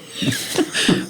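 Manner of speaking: a soft airy giggle; breathy high laugh. Real, not acted. Laughing while telling the friend about the baby. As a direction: giggle lightly at the memory.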